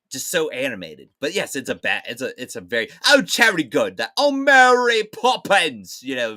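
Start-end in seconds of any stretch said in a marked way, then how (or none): 3.1-6.0 hammed-up British accent, much higher and slow; over-the-top fake British accent, high and drawn out; mock British accent. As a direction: overdo a Cockney-ish British accent like a bad Mary Poppins. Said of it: "it was so over the top".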